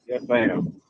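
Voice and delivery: ordinary talk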